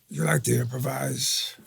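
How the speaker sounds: slow, drawn-out question, lightly voiced actor; lightly imitated veteran actor, slowly. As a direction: stretch it out, a hint of impersonation. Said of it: "Al says to me right before".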